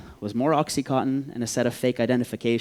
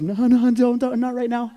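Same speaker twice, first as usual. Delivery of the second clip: high, strained plea to be left alone; squeaky, overwhelmed begging off; panicked refusal; 12 semitones higher, 6 dB louder than usual. Retold or imitated. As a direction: fend off the question in a high panic. Shaken man begs off questions.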